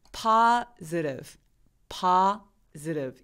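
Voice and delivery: positive said slowly with the mouth fully relaxed, vowels blurred; relaxed schwa. Shown as a demonstration. Teacher demonstrating a relaxed positive to students, slow and slack. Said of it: "구강 구조의 힘을 완전 빼고".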